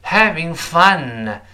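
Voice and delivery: "Having fun" drawn out, both words emphasised; stressed words. Shown as a demonstration. Teacher shows where stress falls, slow emphatic "Having fun". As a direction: stretch "Having fun" high, then fall on "fun". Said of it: "在这是重读".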